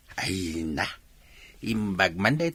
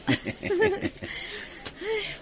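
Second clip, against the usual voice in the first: teasing laugh; 6 dB quieter than usual. Acted. Laughs at ex-boyfriend joke.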